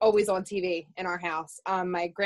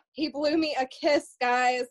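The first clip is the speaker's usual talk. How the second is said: excited gush; 6 semitones higher, 3 dB louder, slower than usual. Real, not acted. Gasping fan gush.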